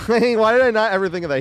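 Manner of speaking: high, loud delighted outburst; excited. Real, not acted. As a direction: burst out with glee.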